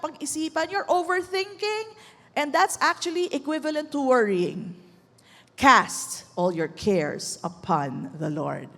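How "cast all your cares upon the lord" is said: low, very slow, measured scripture; solemn exhortation. Real, not acted. Preacher urging worriers to let go, solemn. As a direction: lay each word down deep and slowly.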